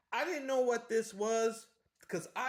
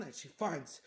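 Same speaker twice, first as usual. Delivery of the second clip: ordinary delivery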